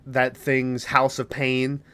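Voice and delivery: normal talking voice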